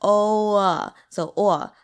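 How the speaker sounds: "oh" opening into an "aw-uh" sound; drawn-out "oh" without closing to oo; Mandarin O. Shown as a demonstration. Teacher demonstrating Mandarin O, open "o-uh". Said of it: "Theirs is more like".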